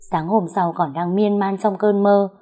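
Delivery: usual speaking voice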